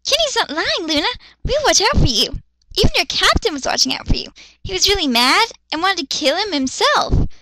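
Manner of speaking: put-on high, quick chirpy kid voice; high excitable child; little girl voice. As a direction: chatter like a hyper little girl. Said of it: "Yachiru voice".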